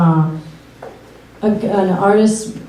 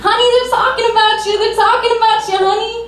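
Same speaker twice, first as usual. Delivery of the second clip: very high, rapid mom voice; high-pitched, hurried mock-mom nagging; imitated mom; 13 semitones higher, much faster than usual. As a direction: do an insistent mother, pitched way up. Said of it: "As my mom's tapping my leg going".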